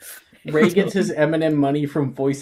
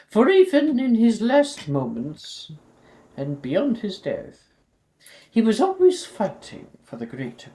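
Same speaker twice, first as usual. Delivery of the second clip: headmistress speech; 8 semitones higher than usual. Acted. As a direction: address the whole school grandly.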